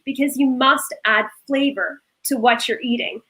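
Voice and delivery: usual speaking voice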